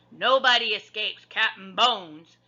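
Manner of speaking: slow, loud pirate captain; pirate roar. A narrator's reading. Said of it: "he roared".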